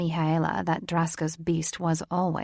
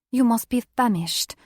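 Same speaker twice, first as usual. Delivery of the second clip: excited mother voice; 5 semitones higher, 6 dB louder than usual. A narrator's reading.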